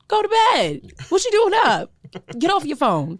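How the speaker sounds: high, loud motherly scolding; Claire Huxtable. Acted. Telling listeners to sleep, scolding TV mom. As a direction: scold them off to bed, high and forceful. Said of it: "Very Claire Huxtable".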